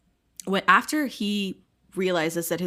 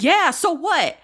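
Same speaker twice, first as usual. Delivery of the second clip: mock defiance, higher and louder; defiant retort; 8 semitones higher, 5 dB louder than usual. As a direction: throw it back like a challenge.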